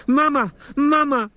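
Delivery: put-on very high, loud toddler wail; a frightened little girl crying for mum. A narrator's reading. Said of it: "wailed Zahara".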